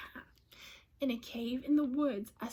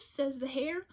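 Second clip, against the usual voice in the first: ordinary delivery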